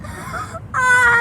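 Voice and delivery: frightened cry. Real, not acted. Scream while sliding.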